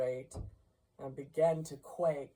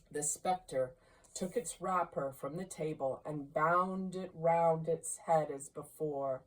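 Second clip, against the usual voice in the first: usual speaking voice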